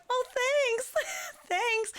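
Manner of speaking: very high, nervous laughing thanks; awkward thanks. Retold or imitated. Bloodied performers accepting compliments, retold awkward politeness.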